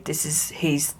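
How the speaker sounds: dropping to a near whisper; hushed. Retold or imitated. Policeman telling the owner, hushed. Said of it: "he said".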